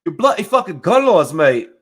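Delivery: put-on high, loud British accent rant; mock British rant. Englishman ripping on American gun laws, in a loud mock British accent. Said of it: "he's like".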